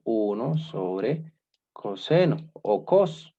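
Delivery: slow, even dictation for note-taking; teacher dictating "Uno sobre coseno o cos" slowly. Shown as a demonstration. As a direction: spell it out for the class to copy at half speed.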